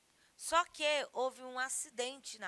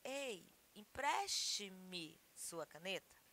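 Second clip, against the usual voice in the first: "empreste-me" said slower and softer, carefully; correct form; 3 semitones lower, 6 dB quieter, slower than usual. Shown as a demonstration. Teacher to students, modelling the correct form, slow. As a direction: lay out "Ei, empreste-me sua caneta" with care. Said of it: "mais correto seria".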